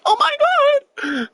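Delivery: very high drawn-out gasp of shock; shocked shriek. Retold or imitated. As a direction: shriek it in disbelief. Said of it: "She's like".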